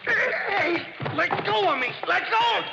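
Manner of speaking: high, loud shout while grappling. Acted. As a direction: yell it as you wrestle him. Grappling with attacker in dark.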